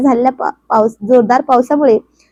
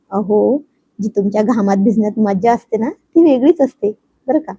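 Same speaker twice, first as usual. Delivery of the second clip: ordinary delivery, nothing marked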